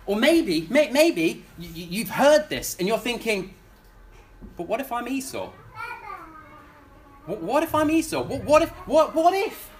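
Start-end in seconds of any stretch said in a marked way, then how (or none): 4.6-9.7 high, rising worried pleading; anxious doubter. Retold or imitated. Preacher voicing a listener who fears not being chosen, anxious. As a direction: ask it as if dreading the answer.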